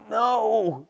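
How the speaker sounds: high, drawn-out screamed refusal; full-voiced scream that falls away at the end. A narrator's reading. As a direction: scream it out long and loud. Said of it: "Coyote screamed".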